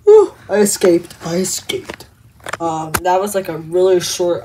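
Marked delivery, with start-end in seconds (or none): 0.0-1.1 louder relieved burst after escaping; relieved exclaim. Acted. Relief after escape, then echoed softly. 1.2-2.1 soft echo. Acted. Quiet echo of escape.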